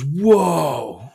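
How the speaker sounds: imitating a startled kid, loud and drawn out; a loud, rising "whoa" mimicking a boy; startled child's whoa. Son behind dad sees the shark pop out, startled. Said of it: "he goes".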